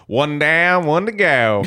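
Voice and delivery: put-on Southern accent. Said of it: "dirty Southerner from the U S".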